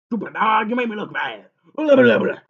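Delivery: loud, high telling-off of the dog; angry scolding. Real, not acted. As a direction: yell at the dog, mocking it at the end.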